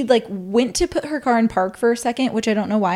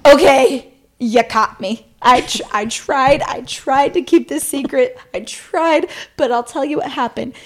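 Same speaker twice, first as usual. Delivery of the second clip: mock confession; 4 semitones higher, 4 dB louder than usual. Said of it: "And I was like".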